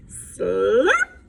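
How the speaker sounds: ordinary delivery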